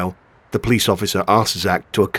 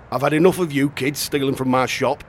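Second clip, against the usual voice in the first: ordinary delivery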